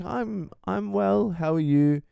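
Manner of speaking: put-on high, slow timid old lady voice; a prim little old lady; sweet old lady voice; polite old lady. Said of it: "She's like".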